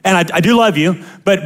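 ordinary talk, nothing marked

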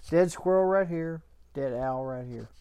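ordinary talk, nothing marked

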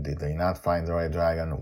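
ordinary delivery, nothing marked

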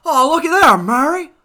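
put-on high, loud old-Hollywood folksy voice; Jimmy Stewart impression. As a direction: exclaim like a 1940s film star. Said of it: "Jimmy Stewart impression".

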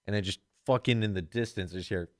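ordinary talk, nothing marked

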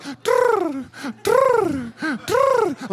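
very high, loud trills; mock bird coo, rolled high; dove cooing. Mocking a dove-like Holy Spirit to the congregation, high trilled coo. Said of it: "doesn't come in like".

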